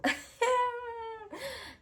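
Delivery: squealing high-pitched laugh; a squeaky embarrassed giggle. Real, not acted.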